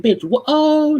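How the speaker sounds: high, cut-off disbelief; shocked. Retold or imitated. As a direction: sputter in shock. Her reaction to his denial.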